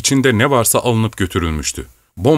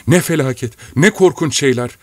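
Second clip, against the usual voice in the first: higher-pitched, dismayed exclamation; mildly voiced distress; horrified lament; 5 semitones higher than usual. A narrator's reading. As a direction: exclaim in acted horror. Maid laments the horrors.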